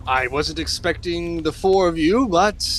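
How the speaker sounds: high, rising heroic declaration; grandiose hero. Acted. Hero pledges to rescue anyone in his path, grandiose. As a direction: vow rescue to all with showy nobility.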